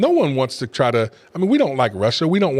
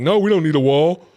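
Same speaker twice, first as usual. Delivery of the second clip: mocking the doubters; 4 semitones higher, 4 dB louder than usual. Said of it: "most Americans were like".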